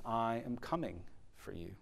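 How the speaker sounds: soft, tender promise; quiet steady promise; hushed divine promise. Acted. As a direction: speak it gently, almost a hush. The voice cries out again.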